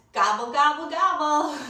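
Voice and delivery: hammed-up turkey call, laughing; gobbling turkey. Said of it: "performs turkey gobble".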